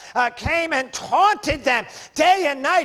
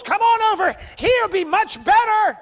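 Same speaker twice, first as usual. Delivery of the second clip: high-pitched, urgent beckoning; acted devil's enticement, pitched right up; eager luring call; 9 semitones higher than usual. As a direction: call them over like a pushy tempter. Devil calling believers over, acted.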